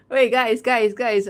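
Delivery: excited call to the viewers. Real, not acted. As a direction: grab everyone's attention. Calling out to chat.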